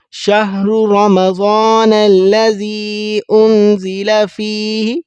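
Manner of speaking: slow melodic Quran chanting; Quran recitation. Acted. (قرآن کی تلاوت، آہستہ اور لحن کے ساتھ)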